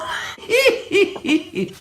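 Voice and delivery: high, wheezy chuckle; laughing. Real, not acted. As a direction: laugh at your own punchline. Laughs after own punchline.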